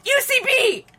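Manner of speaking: mock shout. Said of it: "you pointed at me and went".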